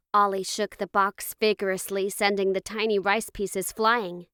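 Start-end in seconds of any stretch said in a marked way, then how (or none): none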